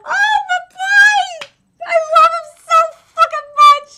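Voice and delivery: high shrieking adoration; fangirl shriek. Real, not acted. Shrieking over beloved character.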